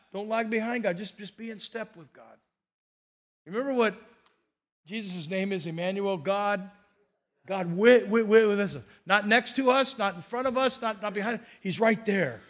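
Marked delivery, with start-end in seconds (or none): none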